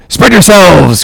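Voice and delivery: high, ringing captain's order; cried command. A narrator's reading. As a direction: cry the order out over the boats. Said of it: "cried Ahab".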